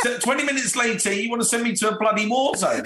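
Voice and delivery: racing through it, laughing at the absurdity; laughing disbelief. Real, not acted. Laughing incredulity, fast.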